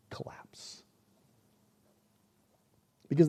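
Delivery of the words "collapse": a whispered collapse. Acted. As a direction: breathe the word as a whisper. Moses near emotional collapse.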